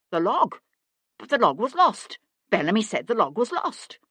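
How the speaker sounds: cornered and incredulous; panicked disbelief. A narrator's reading. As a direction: blurt it out in alarm. Cornered man panics at revelation.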